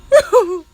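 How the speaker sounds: loud high laugh, near crying; tearful laugh. Real, not acted. Host overcome by memory, loud tearful laugh. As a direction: cackle until you cry. Cracks up, tearing up.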